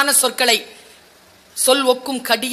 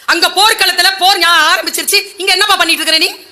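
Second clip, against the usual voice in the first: raised, sharp scolding, a bit hurried; angry elder brother; 6 semitones higher, 4 dB louder than usual. Acted. Dharma scolding Arjuna in Draupadi's tent, angry. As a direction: scold him like the furious eldest brother. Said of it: "கோபமா கேட்டாராம்".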